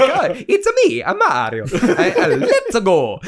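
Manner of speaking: a hammed-up, bad Italian accent as Mario. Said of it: "an Italian accent, like, a really bad one".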